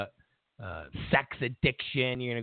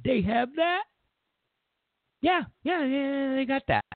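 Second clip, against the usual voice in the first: much higher, doubtful questioning wife; 10 semitones higher than usual. Pretend. Wife questioning her cheating husband, high and skeptical. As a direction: play the skeptical wife asking what now.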